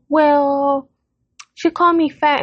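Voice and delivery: high, drawn-out "well" in mock protest; imitated sulky child. Daughter protesting to her dad, imitated sulky child.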